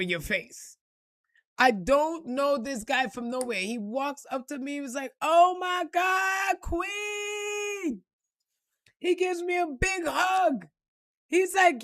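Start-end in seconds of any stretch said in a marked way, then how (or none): 5.2-8.0 high, drawn-out gushing cry of delight; mock fan squeal, slow and high; overjoyed greeting. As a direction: gush over her like a long-lost star. Man greets her, overjoyed.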